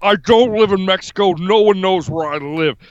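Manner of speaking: hammed-up tough-guy politician impression; comic strongman bluster; Jesse Ventura. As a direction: declare it like a blustering off-grid candidate. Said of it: "Jesse, why do you live in Mexico".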